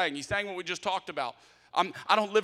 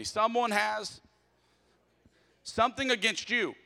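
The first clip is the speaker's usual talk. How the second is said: read together; 7 semitones higher, 4 dB louder than usual. Shown as a demonstration. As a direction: lead the room through it. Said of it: "say this next part with me".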